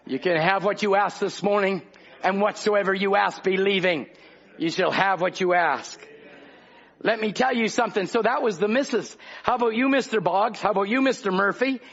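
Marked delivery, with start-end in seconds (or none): none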